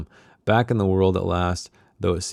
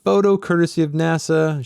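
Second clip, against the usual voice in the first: high mock scream at the mirror; 9 semitones higher, 3 dB louder than usual. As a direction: shriek it in a raised voice. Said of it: "screaming with her nose to the mirror".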